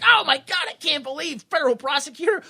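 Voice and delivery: high, panicked retelling; comic panic, pitched high; mock freak-out. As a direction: imitate people freaking out, voice shooting up. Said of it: "they freak out, like".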